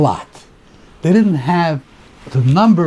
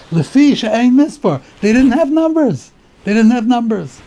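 excited emphasis; 9 semitones higher, 4 dB louder than usual. Real, not acted. Teacher stresses that numbers ran out.

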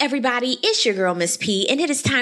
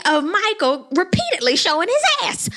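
exasperated; 10 semitones higher than usual. Real, not acted. Exasperated at the show.

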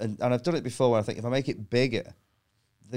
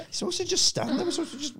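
much higher, confused indignant crowd; mock audience voice; 4 dB quieter than usual. The audience complaining he should just stand there, high mock whine. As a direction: whine like a puzzled punter.

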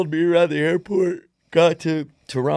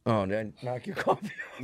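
normal talking voice

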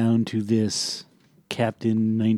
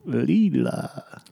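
put-on cartoon captain, higher; Zapp Brannigan; 4 semitones higher than usual. Retrying a cartoon captain's catchphrase, put-on voice. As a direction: sing out the name as a cartoon captain. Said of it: "I can't do Zap Brannigan right now".

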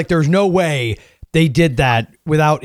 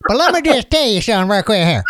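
faux Southern accent; 8 semitones higher than usual. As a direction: tell a story as a cartoon Southern bumpkin. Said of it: "put on this kind of faux Southern accent".

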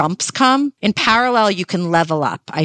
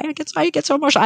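choked up; 10 semitones higher, much faster than usual. Real, not acted. Talking about her little one, she gets emotional and her voice jumps high and fast. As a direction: pitch jumps much higher and rushes fast, welling up. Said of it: "I'm, like, gonna cry".

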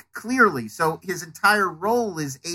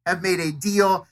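usual speaking voice

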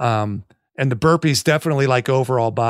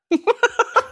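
high squeal of laughter. Real, not acted. Laughing at a funny show.

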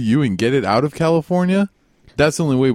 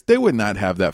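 usual speaking voice

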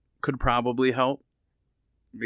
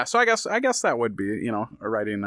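usual speaking voice

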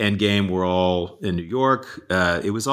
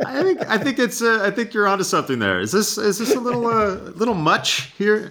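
higher, laughing through the words; high laughing reply; 12 semitones higher than usual. Real, not acted. Answering the host's teasing, high and laughing. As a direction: giggle while playing along.

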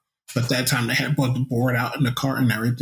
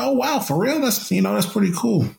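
imitating a friend, higher and amazed; mock wide-eyed wow, pitched up; 6 semitones higher than usual.

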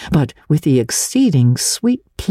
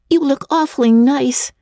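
put-on a higher, friendly girl voice; lightly voiced girl Diana; 11 semitones higher than usual. A narrator's reading. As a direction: pass on a compliment sweetly.

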